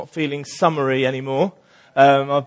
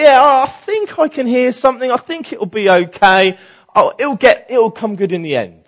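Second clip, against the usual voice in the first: forced cheer; 7 semitones higher, 5 dB louder than usual. Retold or imitated. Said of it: "we can be the pretender".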